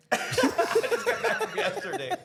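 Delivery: high, laughing repeat of the line; laughing mimic. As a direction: echo it while cracking up. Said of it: "repeats friend's line laughing".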